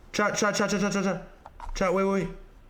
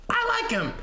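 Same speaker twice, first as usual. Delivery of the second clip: much higher, falling on I; high squeaky voice. Acted. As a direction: squeak it out playfully. Squeaky repeat to chat.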